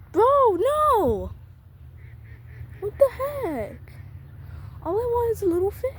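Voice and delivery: high, loud exasperated cry; frustrated yell. Real, not acted. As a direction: wail at the fish that got away. Fish steals the bait.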